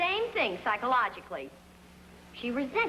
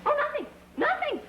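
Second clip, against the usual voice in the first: high-pitched, innocent cover-up; falsely innocent, squeaky denial; caught-out denial; 13 semitones higher than usual. Acted.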